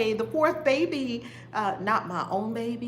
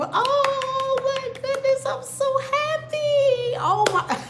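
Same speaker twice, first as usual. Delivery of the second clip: high, slow squealing joy breaking into laughter; overjoyed; 5 dB louder, slower than usual. Real, not acted. Overjoyed by a viewer's message, squealing into laughter.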